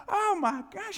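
loud, high retold amazement; high, excited burst of discovery; astonished realization. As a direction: exclaim it as if it just hit you. Acts out rediscovering the note.

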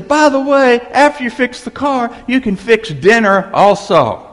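high, very loud parting shot; hopping-mad wife. Acted. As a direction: act out a furious wife hurling orders. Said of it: "hopping hot".

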